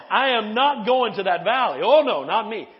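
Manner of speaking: loud, punchy acted refusal; defiant refusal. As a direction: hammer out each word as a playful refusal. Voices Nehemiah refusing to go.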